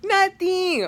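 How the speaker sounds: high, drawn-out brush-off; defensive reply. Retold or imitated. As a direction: toss back a high-pitched denial. Acting own reply to mom.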